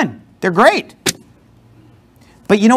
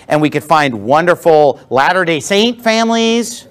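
ordinary talk

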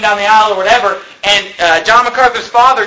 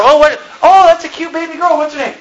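put-on high, gushing baby talk; mock cooing parent; 7 semitones higher than usual. Preacher mocking parents naming a baby, high cooing. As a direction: coo over the baby like a proud mom.

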